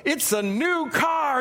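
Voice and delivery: high, drawn-out prize reveal; big, slow showman's reveal; game-show announcer. Retold or imitated. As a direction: announce the grand prize like a TV host. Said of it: "And they go".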